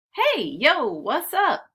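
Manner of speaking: put-on bouncy "hey yo" from a kid; casual kid greeting. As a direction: call out to your friend cool and easy. Friends greet in the park.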